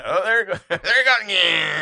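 high, loud, laughing cheer; excited shout. Retold or imitated. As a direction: cheer along to the riff. Excited reaction to the song.

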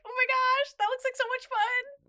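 high, giddy fan reaction; re-enacted thrilled squeal; excited gushing. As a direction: gush with starting burst of volume. Voicing own excited reaction.

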